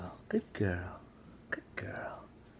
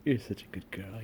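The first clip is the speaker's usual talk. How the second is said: gentle cooing praise; soft pet talk; 7 semitones lower, 5 dB quieter than usual. Real, not acted. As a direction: coo it to the cat. Repeated praise to cat.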